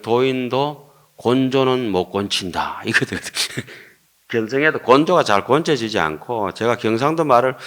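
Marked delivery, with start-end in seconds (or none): none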